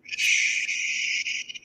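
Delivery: a breathy whispered "Shh"; hushing. Acted. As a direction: silence them with a hush. Hushing back in a scene.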